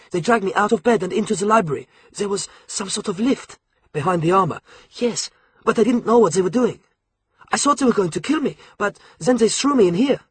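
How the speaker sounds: a shaken schoolboy blurting out his ordeal; boy recalling being dragged from bed, frightened; James, scared boy. A narrator's reading. James voiced in retelling.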